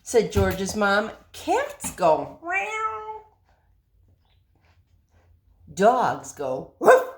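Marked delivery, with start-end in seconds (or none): 1.3-3.3 very high, slow cat meow; mock meow. Mother teaching cat sounds, high slow meow. 5.7-7.1 woofing dog. Acted. Mother shows the puppy how dogs woof, loud.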